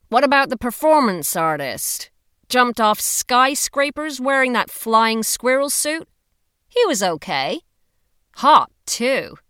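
prodding a friend about her ex; teasing friend voice. A narrator's reading.

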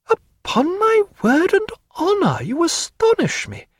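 high slow wonder; a lady caught off guard by a proposal; astonished. A narrator's reading. Narrator voicing Diana.

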